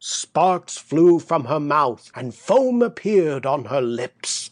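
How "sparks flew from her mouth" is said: ordinary delivery, nothing marked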